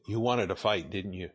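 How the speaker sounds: a quiet, knowing jab; leaning in quietly. Retold or imitated. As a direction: lean in close and drop the voice to a sly murmur. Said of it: "the clerk kinda leans over a little closer and says".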